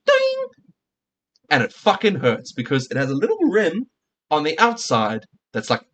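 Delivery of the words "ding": high ringing "ding" imitating a can hitting a head; Pringles can ding. Telling how a Pringles can sounded hitting his head, high ringing ding. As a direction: ring out a sharp metallic ding. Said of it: "You hear the sound, it goes".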